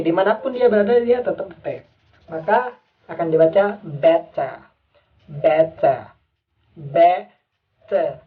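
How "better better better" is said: better said British, betta, crisp T and no R; British better with a hissed T; British pronunciation. Shown as a demonstration. Teacher demonstrating British better, T kept. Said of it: "dalam British itu T tetap T".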